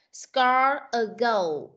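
score a goal very slowly; slow model. Shown as a demonstration. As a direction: stretch each word for the child. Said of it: "teacher models phrase". (đọc mẫu rất chậm)

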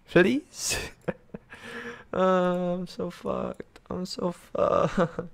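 giggling despair at a lost game; laughing despair. Real, not acted. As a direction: laugh helplessly at your own doom. Laughing at a hopeless position.

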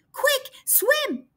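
high, urgent cry to swim; urgent shout. Acted. Rossi urging Gasper off the breaking ice, high shout. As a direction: yell at him to jump in. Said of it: "shouted Rossi".

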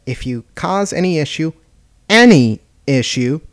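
pause, then lean hard on "any issue"; impressive pause. Acted. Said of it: "she paused impressively".

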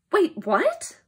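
high, rising double take; baffled surprise. Acted. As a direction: pull up short, confused. Baffled by nonsense word just read.

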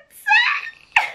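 very high drawn-out squeal of delight; excited squeal. Real, not acted. Vlogger revealing a long-awaited package, excited squeal. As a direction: squeal it out, laughing.

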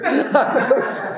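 high helpless giggle; a real giggle breaking out. Real, not acted. Laughing at own reply.

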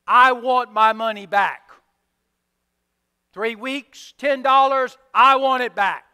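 loud, hard demand; demanding servant. Acted. Servant demanding ten dollars from the man, loud. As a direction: insist on every dollar.

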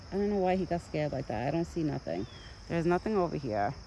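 normal talking voice

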